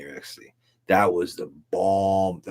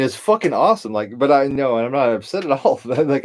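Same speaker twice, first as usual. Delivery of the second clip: ordinary delivery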